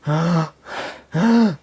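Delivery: soft breathy gasping, imitating a scared father; hushed fearful ah, ah, ah; frightened gasps. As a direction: gasp in sudden fear, under the breath. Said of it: "puso ese rostro de miedo de".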